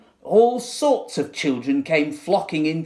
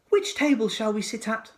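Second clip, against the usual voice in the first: put-on child voice; 9 semitones higher than usual. A narrator's reading. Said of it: "said one".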